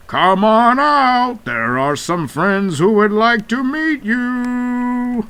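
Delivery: sing-song shout toward the hideout; calling out. Acted. Calling the monster out to meet friends.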